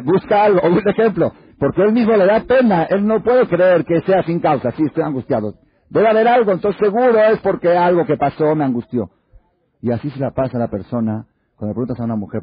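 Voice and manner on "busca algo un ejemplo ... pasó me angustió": loud, high, forceful preaching; full-force preaching. Acted. Rabbi describing the man searching for a cause, preaching hard. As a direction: drive the point home hard.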